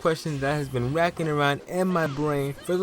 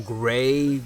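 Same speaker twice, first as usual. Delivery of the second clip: ordinary delivery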